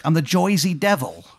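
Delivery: put-on Jersey accent. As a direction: play a monster from Jersey, vowels and all. Jersey Devil speaks in character.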